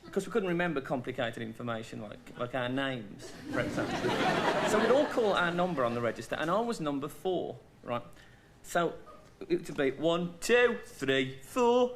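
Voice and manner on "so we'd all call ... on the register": ordinary talk, nothing marked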